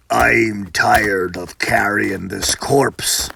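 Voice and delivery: deep, slow voice of a huge brute; weary giant grumbling. Acted. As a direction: drag it out low like a hulking man. Huge man complains, deep voice.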